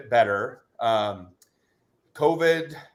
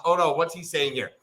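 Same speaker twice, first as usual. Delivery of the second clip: ordinary talk, nothing marked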